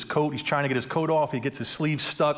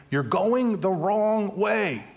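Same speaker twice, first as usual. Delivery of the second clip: high, slow drawn-out call; 6 semitones higher, much slower than usual. Retold or imitated. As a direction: shout it across the highway. Passing car calling over highway.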